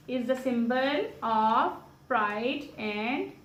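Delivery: very slow, words drawn out; slow dictation. Real, not acted. Mother dictating a homework sentence to her child, slowly. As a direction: dictate word by word, leaving gaps for writing.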